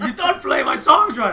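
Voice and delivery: loud, high complaint through laughter; mock scolding. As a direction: imitate an offended star yelling, amused. Imagined hero scolding, laughing.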